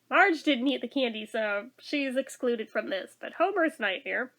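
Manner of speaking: usual speaking voice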